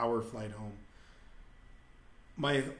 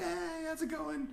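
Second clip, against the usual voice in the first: high, soft, sing-out hello; mock friendly greeting; 7 dB quieter than usual. Topless girls greeting a newcomer, mock high-pitched friendliness. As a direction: imitate girls calling a light, high hey.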